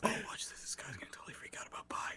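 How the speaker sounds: hushed whisper of a sneaky bystander. Pretend. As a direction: whisper it so the subject can't hear. Imitated bystander filming secretly.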